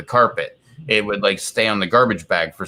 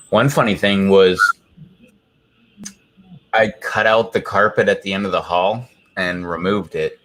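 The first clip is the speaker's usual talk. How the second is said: ordinary talk, nothing marked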